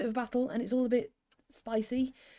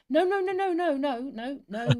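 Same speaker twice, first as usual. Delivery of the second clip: high, loud run of denials; hasty protest; 6 semitones higher, 6 dB louder than usual. Real, not acted. Host reassuring chat she won't censor, hasty high protest. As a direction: jump in quickly to protest. Hastily refusing to censor anybody.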